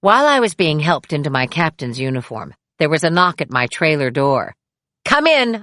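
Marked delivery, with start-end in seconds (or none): 5.1-5.6 called out. Retold or imitated. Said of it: "I called out".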